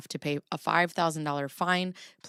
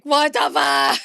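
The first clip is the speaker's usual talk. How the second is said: shocked outburst; 8 semitones higher, 9 dB louder than usual. Retold or imitated. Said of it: "And then I was like".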